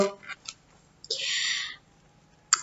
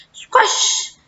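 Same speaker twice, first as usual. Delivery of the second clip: comic-book exclamation; 11 semitones higher than usual. A narrator's reading. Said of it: "esclamò subito".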